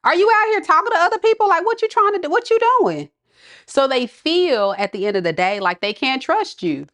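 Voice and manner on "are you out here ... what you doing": high, loud accusing rant; jealous partner confronting Taurus, high and loud; mock jealous outburst. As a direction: fire questions like a jealous partner. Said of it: "They like".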